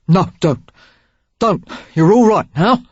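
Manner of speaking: hurried gruff reassurance; awkward comforter. A narrator's reading. Derek awkwardly soothing crying Sarah, gruff reassurance.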